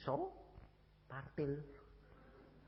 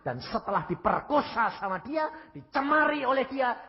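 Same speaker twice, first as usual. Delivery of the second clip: raised, forceful denunciation; outraged accusation; 8 semitones higher, 6 dB louder than usual. Retold or imitated. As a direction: press the charge with rising voice. Accusation continues in retold confrontation. (tuduhan geram bersuara tinggi)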